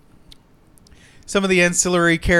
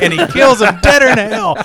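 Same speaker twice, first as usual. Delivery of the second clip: ordinary talk, nothing marked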